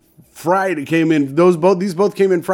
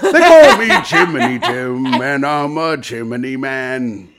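Cockney chimney sweep; 3 semitones lower than usual. Acted. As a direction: play up a cheery London sweep. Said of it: "That's my new character".

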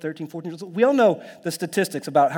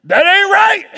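high, full-volume heckle; imitated outraged shout; shouted objection; 11 dB louder, slower than usual. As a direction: holler it out from the crowd. Imitating one man's shouted objection.

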